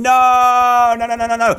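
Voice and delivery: high, loud drawn-out no, then rapid repeats; high-pitched frantic protest; panicked wail. Real, not acted. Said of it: "I'm so scared of everything".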